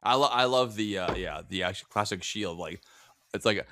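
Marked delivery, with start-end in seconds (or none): none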